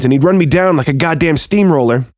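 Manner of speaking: usual speaking voice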